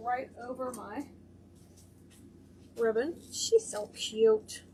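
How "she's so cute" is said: high, slow doting coo. Real, not acted. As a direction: melt over how cute it is. Cooing at the ribbon piece.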